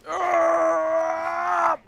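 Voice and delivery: loud, high frustrated yell of defeat; frustrated shout. Real, not acted. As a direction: cry out at the broken addon. Addon still broken.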